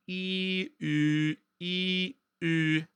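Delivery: sustained "E", then round lips into "U"; vowel rounding demo. Shown as a demonstration. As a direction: alternate "E" as ee and "U" as German ü, held level. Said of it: "make a sound E and then round your lips".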